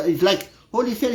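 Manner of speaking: usual speaking voice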